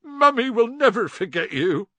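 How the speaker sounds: high, tearful wail; sobbing. A narrator's reading. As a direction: weep it out like a parent. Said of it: "Hagrid sobbed".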